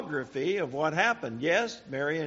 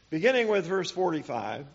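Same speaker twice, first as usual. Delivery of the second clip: ordinary talk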